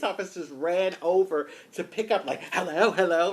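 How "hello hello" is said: loud, high, eager hello; like little boys. Retold or imitated. Officers racing to the payphone, like little boys. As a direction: mimic excited boys grabbing a phone. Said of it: "like little boys".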